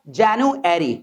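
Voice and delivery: January as "jan-u-ary", no v; January without v sound. Shown as a demonstration. Teacher models January for students, no v sound. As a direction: drop the v in January. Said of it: "व की sound को आप किल कर सकते हैं".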